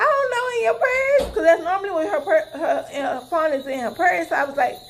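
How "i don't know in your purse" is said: anxious retold answer. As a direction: squeak it out in a flustered rush. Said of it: "I was anxious".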